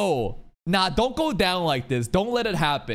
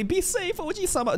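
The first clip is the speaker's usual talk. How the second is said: mock tender farewell; 13 semitones higher than usual. Imitating Fanatio bidding Bercouli farewell, high and mock tender. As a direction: play the worried knight seeing him off, half-mocking. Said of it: "She looked at Berkeley".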